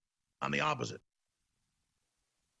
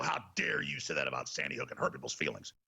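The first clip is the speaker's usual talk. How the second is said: low mock-outraged scolding; mimicked indignation; 14 semitones lower, 5 dB quieter than usual. Critics scolding host about Sandy Hook, mock-indignant. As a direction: play the offended accuser, pitched down. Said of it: "Or they go".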